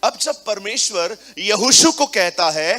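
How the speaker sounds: loud, fervent sermon delivery; rising pulpit fervor; full-force preaching. Acted. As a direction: preach it out to the congregation.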